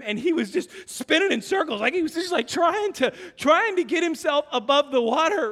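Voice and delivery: normal talking voice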